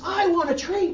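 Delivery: put-on high, loud little-girl yell; tantrum yell. Angry girl yelling at her teacher for a treat, a high loud yell. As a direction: yell it like a kid losing her temper.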